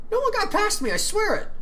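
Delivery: put-on a young princess swearing loyalty on guard duty; vehement princess. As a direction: insist on your innocence, high and loud. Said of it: "the princess answered vehemently".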